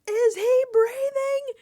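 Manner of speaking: high, loud, drawn-out mock scream; retold scream. Staff running down the docks to the boat, screaming. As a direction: scream it slowly like frantic staff. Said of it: "staff are running down the docks screaming".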